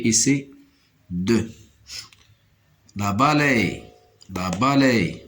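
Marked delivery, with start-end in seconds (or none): none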